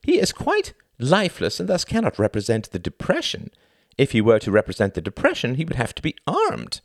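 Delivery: high, showy stage-host voice; theatrical thin man. Acted. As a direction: play the pompous thin performer lecturing the audience. Thin man explains the metaphor.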